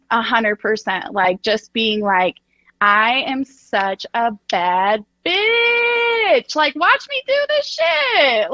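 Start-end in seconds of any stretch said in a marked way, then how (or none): 2.8-8.5 confident swagger. Retold or imitated. Acted confident self-talk.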